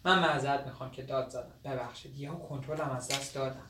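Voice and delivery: soft, low, contrite; subdued apology. Acted. Young man apologising to her for shouting, subdued. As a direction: drop right down and confess.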